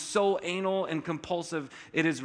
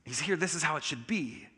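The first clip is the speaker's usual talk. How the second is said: relieved staff; 7 dB quieter than usual. Retold or imitated. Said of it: "there's a sense of".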